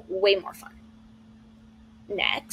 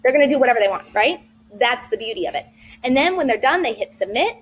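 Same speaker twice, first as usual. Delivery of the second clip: ordinary talk, nothing marked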